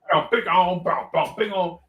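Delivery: punchy vocal horn riff; imitated funk horns. Shown as a demonstration. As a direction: sound out a funk groove with the mouth.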